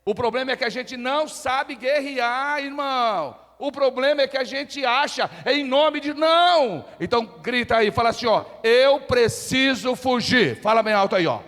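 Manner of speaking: high, very loud shouted sermon; full-force preaching. Real, not acted. Preacher urging the congregation to shout along, full-force preaching. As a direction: thunder it out, stretching the key words.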